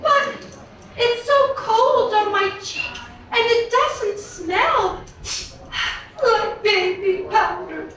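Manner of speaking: put-on high girlish princess voice; a little princess, high and sweet. Princess answers father.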